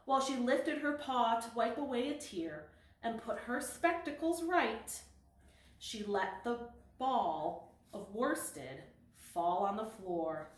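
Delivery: ordinary delivery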